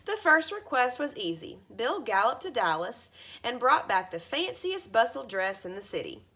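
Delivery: ordinary delivery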